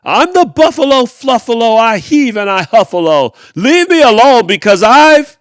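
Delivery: very loud, high furious roar of the motto; snarling shout. Acted. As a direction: stomp and bellow every word with rage. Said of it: "he snarled and he gnarled and he stomped".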